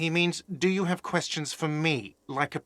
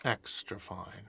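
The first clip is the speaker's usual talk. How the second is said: quiet slow murmur; 7 semitones lower, 8 dB quieter, slower than usual. Acted. Slow quiet tag on line.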